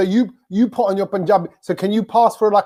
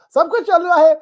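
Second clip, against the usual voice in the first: mocking Hindi default line; 8 semitones higher, 4 dB louder, faster than usual. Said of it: "standard default line".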